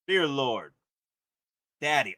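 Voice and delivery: ordinary delivery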